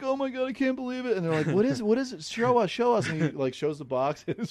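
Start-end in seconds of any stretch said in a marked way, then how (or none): 0.0-1.2 high-pitched imitation of overwhelmed gratitude; mock tearful. Friend acts out prankster faking sentiment over gift, mock tearful. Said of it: "he's acting like he's crying". 1.5-3.1 high-pitched mock excitement; imitated eager pleading. Friend voices family urging prankster to reveal gift, mock eager. Retold onlookers begging to see gift.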